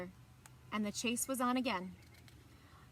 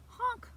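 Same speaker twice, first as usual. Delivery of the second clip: goose honk; 12 semitones higher than usual. A narrator's reading. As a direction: squawk it like a goose. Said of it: "went the goose".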